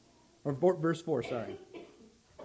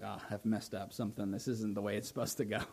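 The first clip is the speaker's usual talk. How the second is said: inner realization; 7 semitones lower, 9 dB quieter than usual. Retold or imitated. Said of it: "you knew, like".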